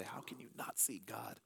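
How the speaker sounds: very soft whisper of wonder; awed whisper. Real, not acted. Pastor marvelling at foggy mountains, hushed whisper. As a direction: breathe it out in awe. Awed remark on Washington fog.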